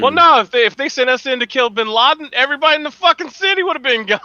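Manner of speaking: high, loud bragging; fired-up Marine bragging; excited boast. Real, not acted. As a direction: brag hard about the Marines.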